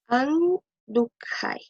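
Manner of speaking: Andukhai drawn out syllable by syllable, very slow; slow model pronunciation. Shown as a demonstration. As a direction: stretch out Andukhai slowly, like a teacher showing the class. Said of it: "teacher models the future form".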